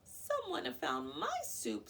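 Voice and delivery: high sputtering little bear; sputtering baby bear. A narrator's reading. Baby Bear sputtering over the lost soup. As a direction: sputter it in a squeaky voice. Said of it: "sputtered Baby Bear".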